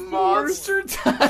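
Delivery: high, slow words through laughter; helpless giggles; laughing hard. Real, not acted. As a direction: squeeze it out between laughs. Still cracking up at answer.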